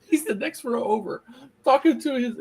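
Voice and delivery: high, laugh-filled telling. Real, not acted. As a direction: talk through a real laugh. Laughing while telling the story.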